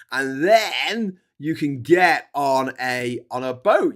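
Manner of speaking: ordinary talk, nothing marked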